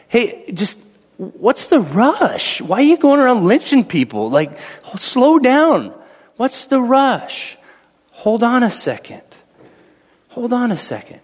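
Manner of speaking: a calm prisoner stalling the captain; calm appeal. Retold or imitated. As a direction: ease the guard off, unhurried. Daniel voiced pleading for time.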